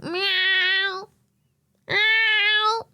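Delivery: long drawn-out high meow; demanding cat meow. Retold or imitated. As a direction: imitate a cat asking for food. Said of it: "Unless he wants to play or have food".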